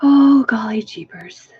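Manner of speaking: breathy exasperated whisper. Real, not acted. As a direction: sigh it out under your breath. Frustrated whisper at camera.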